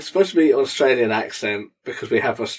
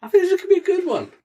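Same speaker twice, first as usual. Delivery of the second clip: very high, fast squeak; squeaky voice; faster than usual. Pretend. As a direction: squeal it with glee. Squeaky high excited line.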